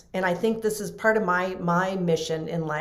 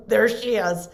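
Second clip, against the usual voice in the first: a laugh then louder, mock exasperated; laughing mock groan; 3 semitones higher, 6 dB louder than usual. Nurses mocking the protective wife, laughing. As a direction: laugh as you groan at her arrival. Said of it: "they would be".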